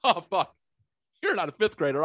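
high, sudden surprise on oh fuck; startled curse. Retold or imitated. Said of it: "he went from fragile old man".

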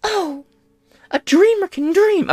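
very high-pitched imitation of women; exaggerated falsetto parody; mocking women's voice. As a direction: put on a high, gushy mock-female voice. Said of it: "Women are gonna look at it like".

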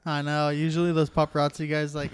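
ordinary talk, nothing marked

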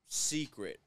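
usual speaking voice

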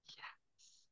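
soft, breathy little whisper; whispered yes. Acted. Childlike self answering the universe in a soft whisper.

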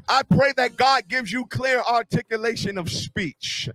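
rhythmic, rising prayer preached out; fervent preaching. Real, not acted. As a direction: pray it over the congregation with mounting force. Preaches at full force.

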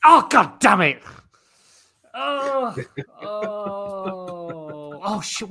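loud exasperated groan of defeat; frustrated outburst. Real, not acted. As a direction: blurt it out, annoyed and loud. Just told wrong guess.

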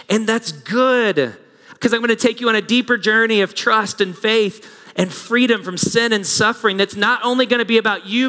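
high, driving sermon voice; impassioned preaching. Real, not acted. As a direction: urge them on with mounting, unbroken conviction. Preaches at full force as Jesus.

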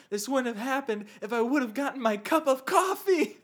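hammed-up actor griping; Brendan Fraser. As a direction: complain indignantly, building toward laughter. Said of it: "Brendan Fraser pops up, and he's like".

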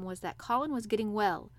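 ordinary delivery, nothing marked

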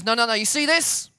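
high, loud, pressing question; insistent. Retold or imitated. Therapist making the kid look at pictures, insistent.

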